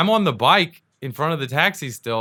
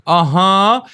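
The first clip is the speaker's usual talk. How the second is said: higher, louder mock-cocky noise; taunting challenge; 6 semitones higher, 7 dB louder than usual. Imitating a taxi driver daring an angry passenger, taunting. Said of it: "What are you gonna do about it".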